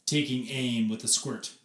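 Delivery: usual speaking voice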